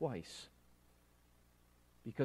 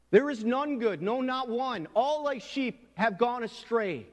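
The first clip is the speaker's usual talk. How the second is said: high, loud scripture proclamation, slowing at the end; booming pulpit quote; proclaimed scripture; 9 semitones higher, 7 dB louder, slower than usual. Real, not acted. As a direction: declare the verse like a verdict. Quoting scripture with force.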